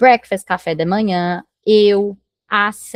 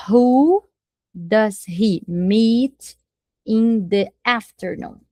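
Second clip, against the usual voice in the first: slow dictation; much slower than usual. Shown as a demonstration. Teacher builds question word by word.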